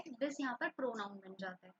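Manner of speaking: usual speaking voice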